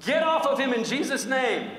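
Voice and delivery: loud, high-pitched imitation of a healing command; commanding shout. Campus pastor commands the wart off a kid, commanding shout. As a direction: belt out the pastor's rebuke.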